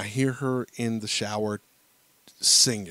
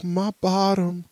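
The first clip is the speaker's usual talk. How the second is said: imitating his daughter; 9 semitones higher, 5 dB louder, slower than usual. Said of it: "And she's like".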